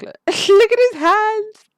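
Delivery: high squealing laugh through the words, slow; cracking up while pointing something out. Real, not acted. Laughing at character's hands.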